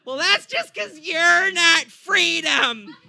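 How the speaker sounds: high, loud, slow caricature of an American; America personified. Acted. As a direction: fire back as a brash cartoon American. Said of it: "starts going after America".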